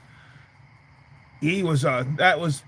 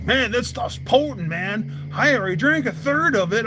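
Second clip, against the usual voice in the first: higher, awed report; impressed buddy; 6 semitones higher than usual. Retold or imitated. Buddy telling how potent the Everclear is, higher and awed. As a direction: marvel at how strong it is. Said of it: "He's like".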